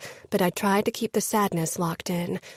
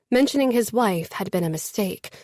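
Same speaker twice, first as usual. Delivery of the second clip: usual speaking voice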